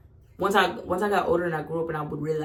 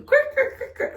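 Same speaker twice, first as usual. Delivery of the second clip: high laughing crick crick noises; creaking nose sound; 7 dB louder than usual. Retold or imitated. Showing the audience how her torn nose swung, a laughing high creak. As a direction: squeak out the creak of a dangling nose. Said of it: "that's how my shit was hanging".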